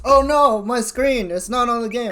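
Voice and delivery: high, loud, frantic pleading; urgent repeated warning, voice jumping up; panicked. Real, not acted. Streamer to viewers as the screen shows by accident, panicked.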